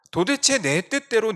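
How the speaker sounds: high, loud cry of frustration; frustrated outburst. Retold or imitated. Preacher voicing Jonah, loud frustrated cry. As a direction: voice Jonah's bitter complaint.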